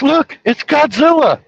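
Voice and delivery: put-on high, loud, slow kid's shout; kid yelling Godzilla. Boy pointing at his mom's friend, a high slow shout. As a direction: cry out like a kid spotting a monster.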